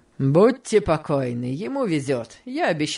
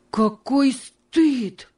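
much higher, louder, dragged out very slowly; exclaiming; 9 semitones higher, 4 dB louder, much slower than usual. A narrator's reading. George sees Tom's chains and exclaims, slow and loud. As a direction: fling the words up to heaven. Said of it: "воскликнул он".

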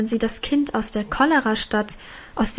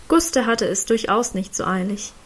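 ordinary delivery

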